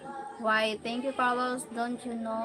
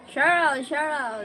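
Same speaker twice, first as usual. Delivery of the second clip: higher, louder sing-song taunt of a name; imitating a bully; 5 semitones higher, 7 dB louder than usual. Retelling how the grade school bully taunted her, mock sing-song teasing. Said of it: "he bullied me like this".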